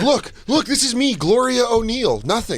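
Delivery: high-pitched mock demonstration; playful high-voiced woman imitation; imitated psychic demonstration. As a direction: announce it high and deliberate, like a showman. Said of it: "She goes".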